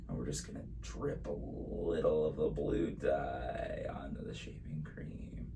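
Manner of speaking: hushed whisper while working. Real, not acted. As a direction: whisper it softly. Quiet aside while dripping dye.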